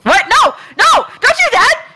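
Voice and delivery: high, pleading cry; hammed-up desperate plea; mock panic. Pleading against sacrifice.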